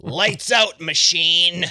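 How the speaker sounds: loud, high victory taunt; shouted one-liner. Acted. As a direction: call out the finishing blow. Finishing blow one-liner.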